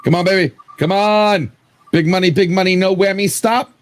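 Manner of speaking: root for the wheel to land big; big money no whammy hype; excited cheering. Real, not acted.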